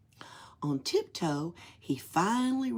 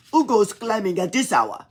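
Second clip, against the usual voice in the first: put-on louder Russian-accented Nutcracker challenge; 3 semitones higher, 9 dB louder than usual. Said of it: "he spoke in a Russian accent".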